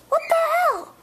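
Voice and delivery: high startled cry; shocked high yelp. Acted. Shocked outburst.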